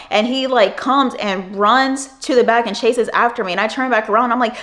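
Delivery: ordinary delivery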